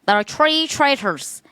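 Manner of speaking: high, slow bad accent; mock accent. Repeating joke accent.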